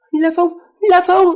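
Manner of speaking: high falsetto woman calling out, loud; man doing a woman's voice. Acted. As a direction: call his name in a high woman's voice. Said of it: "giả giọng nữ nó hơi, ờ, kỳ kỳ xíu nha".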